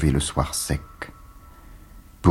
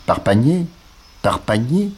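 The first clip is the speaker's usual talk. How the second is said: much higher, louder, lifting at the end; rising excitement; 9 semitones higher, 3 dB louder than usual. Acted. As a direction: fling the words upward with delight. Poème lu, répétition exaltée. (élan exalté, montée aiguë)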